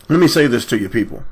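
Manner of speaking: usual speaking voice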